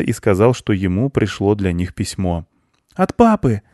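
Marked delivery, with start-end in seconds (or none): 2.9-3.6 put-on high child's happy exclamation; overjoyed boy. A narrator's reading. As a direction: exclaim like a delighted little boy. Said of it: "обрадовался мальчик".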